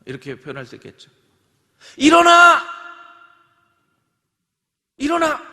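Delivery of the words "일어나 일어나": loud, high shout to wake someone. Shown as a demonstration. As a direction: yell at a sleeper to get up. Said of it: "조금 더 리얼하게 표현하면".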